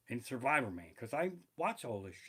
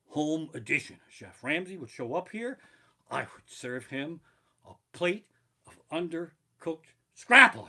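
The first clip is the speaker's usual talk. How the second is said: ordinary delivery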